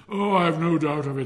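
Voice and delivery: loud, high, breezy assurance; hearty, pompous miller. Acted. Miller answers hopeful Hans, loud and hearty. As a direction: boom out confident reassurance.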